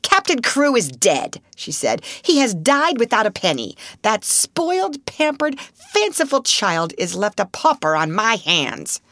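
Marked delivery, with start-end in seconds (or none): 0.0-1.4 blunt and hard; curt. A narrator's reading. Miss Minchin to her sister, curt. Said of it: "wasted no words". 2.2-9.0 high, scornful spite. A narrator's reading. Miss Minchin to her sister, scornful.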